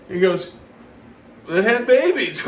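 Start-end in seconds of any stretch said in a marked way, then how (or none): 1.5-2.4 high, loud, on the edge of laughter; gleeful announcement breaking into a laugh; laughing punchline. Retold or imitated. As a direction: deliver the punchline while cracking up.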